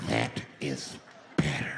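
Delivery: very low, drawn-out repeat; mock-sinister deep voice; menacing low approval. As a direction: drop deep and say it with creepy satisfaction. Same man repeats it, settled down.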